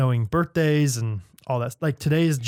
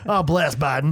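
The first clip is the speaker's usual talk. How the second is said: put-on Southern accent; slower than usual. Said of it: "if you say it in a Southern accent".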